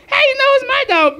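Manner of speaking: put-on high squeaky little girl. Emily talking back to the neighbor, high little girl voice. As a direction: answer back like a sassy five-year-old. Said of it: "Call that little girl up".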